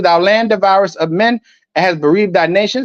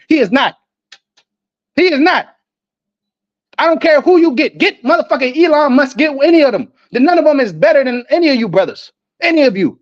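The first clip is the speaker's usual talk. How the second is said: fired-up boast; 6 semitones higher than usual. Real, not acted. Boasting louder, higher.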